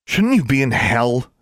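sharp, rising angry challenge; angry question. A narrator's reading. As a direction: throw the question at him in anger. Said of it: "along with her anger".

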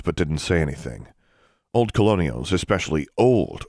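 ordinary delivery, nothing marked

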